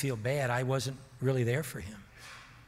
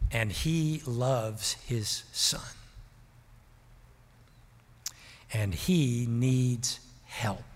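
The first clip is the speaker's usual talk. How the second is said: tender reply; much slower than usual. Retold or imitated. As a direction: let each phrase land gently and slowly. Pastor answering wife tenderly.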